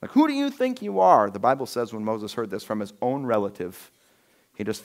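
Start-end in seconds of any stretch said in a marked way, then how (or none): 0.1-1.3 high, pointed accusation; scornful demand; indignant challenge. Retold or imitated. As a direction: Korah challenging Moses. Korah defying Moses.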